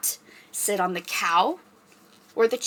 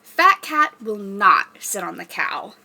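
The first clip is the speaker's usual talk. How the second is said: usual speaking voice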